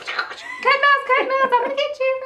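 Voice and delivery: high, loud mock playground squeal; kindergarten kid. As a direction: shriek it like kids playing under a parachute. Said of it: "in kindergarten be like".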